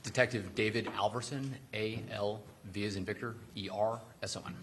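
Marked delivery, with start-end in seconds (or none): 1.7-4.5 letter by letter, each letter separate; spelling it out. Real, not acted. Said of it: "spell your last name".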